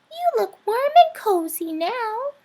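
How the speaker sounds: little mouse content; smiling mouse voice. A narrator's reading. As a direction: mouse voice with a smile. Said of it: "Mortimer smiled".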